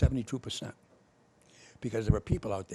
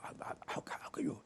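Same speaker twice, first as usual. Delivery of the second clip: whispered disbelief; 9 dB quieter than usual. Retold or imitated. People asking him, retold.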